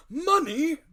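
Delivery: very high, loud indignant shout; the squire scoffs at the doctor's question with a loud cry; incredulous cry. A narrator's reading. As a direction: shriek the word in disbelief. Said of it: "cried the squire".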